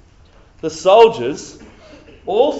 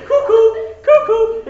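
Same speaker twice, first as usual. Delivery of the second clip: much higher, louder sing-song cuckoo; mocking the crazy kid with a high cuckoo; cuckoo call; 8 dB louder, slower than usual. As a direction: chirp it like a cuckoo clock.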